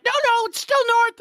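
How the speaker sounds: high, loud mock protest; insistent protest. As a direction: retell a pupil stubbornly insisting, voice pitched right up. Acts out student's insistent denial.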